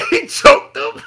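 ordinary delivery

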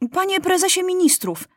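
high-pitched royal interruption; interrupting boy king. A narrator's reading. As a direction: cut in sharply with a high voice. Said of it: "przerwał Maciuś".

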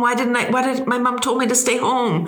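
high-pitched inner panic, acted out; retold freak-out. Paralyzed traveler thinking she should have stayed home, panicking. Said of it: "again, I'm freaking out".

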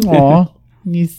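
high-pitched "aw" of cute sympathy; adoring coo. Retold or imitated. Host reacting to the adorable coughing Dalek, high adoring coo. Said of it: "I also thought it was adorable".

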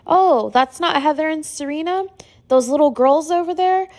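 high, innocently puzzled question; retold puzzled wonder. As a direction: voice a grandma spotting people nobody sees. Dying grandmother sees people, retold.

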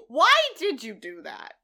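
hammed-up demand for a reason; mock outraged yell. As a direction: cry it out in mock dismay. Repeats outraged line for comedy.

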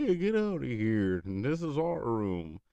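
high animatronic voice, slowing at the end; second animatronic character. Retold or imitated. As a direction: mimic a puppet ordering someone out.

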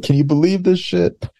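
high-pitched incredulous outrage; imitated indignant complaint; mock angry. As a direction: play up the disbelief. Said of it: "when somebody just becomes angry".

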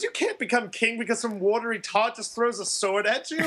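high, incredulous retold protest, amused; mock indignant. Podcast guest retelling a film argument with the king, mock indignant. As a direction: argue it as a high-pitched mock objection, laughing.

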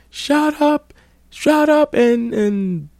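very high mocking squeak; imitating the guy. Guy hushes the girl, very high mocking squeak. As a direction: squeak it like a whiny coward. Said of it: "The guy like".

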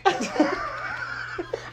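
soft, airy laugh after ranting; breathy laugh. Real, not acted. Laughing at yourself after ranting about kids, a soft airy laugh. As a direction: laugh at yourself for getting so worked up.

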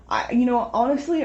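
ordinary talk

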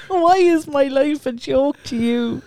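laughing through the words; high, slow, giggling repeat. Retold or imitated. Echoes the complaint laughing.

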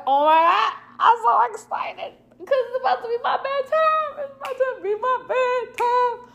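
exhausted giddy squeal over bedtime; mock excited. Worn-out aunt squealing to family that bath means bedtime.

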